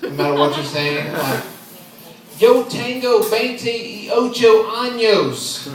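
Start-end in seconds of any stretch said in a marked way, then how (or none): 2.4-5.7 slow, higher, louder Spanish drawled in a thick Southern accent. Shown as a demonstration. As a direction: mangle "Yo tengo veintiocho años" with a Texas twang. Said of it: "whenever you do Spanish in a southern accent".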